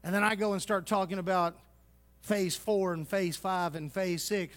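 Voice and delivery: ordinary talk, nothing marked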